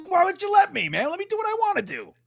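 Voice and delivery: high-pitched mock whining protest; retold protest. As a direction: replay your objection, voice shooting way up. Retold protest at being refused.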